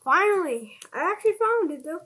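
loud, high burst of relief; relieved excitement. Real, not acted. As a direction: cheer at finally finding it. Found lost stuff.

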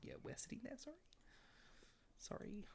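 soft, high whispered apology; whispered polite excuse. Retold or imitated. Gamer voicing her Sim shooing a bouncer, whispered. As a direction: whisper an awkward little sorry to a stranger. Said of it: "I was like".

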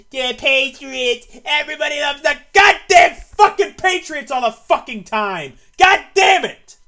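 sneering, furious shout; angry yelling. Real, not acted. Fan ranting that everyone loves the Patriots, furious yelling. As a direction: spit the words out in rage. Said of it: "excuse the yelling".

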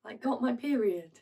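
hushed, defensive stage delivery; subdued, defensive monologue character. Acted. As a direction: quietly stand your ground. Performs monologue from Lungs.

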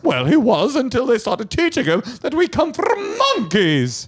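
very high, slow put-on voice; right-winger character. Right-wing relative complaining about school, high slow character voice. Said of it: "I'm the right winger".

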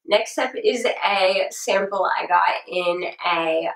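ordinary talk, nothing marked

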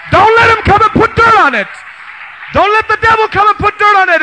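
loud, high, urgent exhortation; preacher urging the youth to keep their enthusiasm, loud and high; full-force preaching. Acted.

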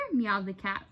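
ordinary delivery

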